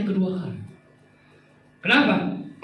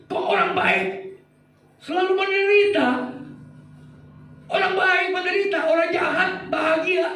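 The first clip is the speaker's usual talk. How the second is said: much higher, rising to a shout; angry Habakkuk; 12 semitones higher than usual. Acted. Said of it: "Marah dia". (Habakuk memprotes Tuhan dengan marah, suara tinggi sampai berteriak)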